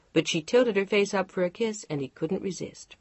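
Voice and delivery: ordinary delivery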